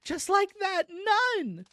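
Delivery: high, wailed NONE in disbelief; indignant repeat. A narrator's reading. Mother repeating daughter's answer to family, aghast wail.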